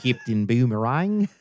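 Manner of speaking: "Captain Boomerang" in Aussie vowels, rising; playful Australian accent. Shown as a demonstration. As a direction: echo it in a put-on Australian lilt. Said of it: "get into an Australian accent".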